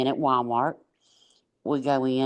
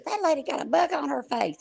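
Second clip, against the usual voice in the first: put-on much higher, a blurting kid; little daughter's voice; 14 semitones higher than usual. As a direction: blurt it out like a small child. Quoting daughter in Walmart.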